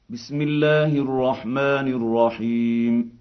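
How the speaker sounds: ordinary delivery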